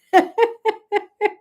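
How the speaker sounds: high, loud laughter; real laugh. Real, not acted. Host laughing with her live audience, high and loud. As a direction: laugh freely.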